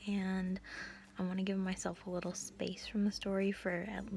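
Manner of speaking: hushed late-night whisper. Real, not acted. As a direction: whisper so nobody wakes.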